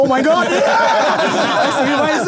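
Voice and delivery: high, loud starstruck squeal with laughter; excited. Retold or imitated. Two friends freak out in the parking lot, high excited. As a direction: relive the giddy shock of meeting.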